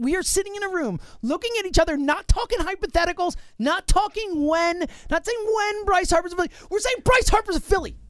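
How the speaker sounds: high, building rally cry; rising excitement. Real, not acted. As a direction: build to a triumphant declaration. Building hype speech.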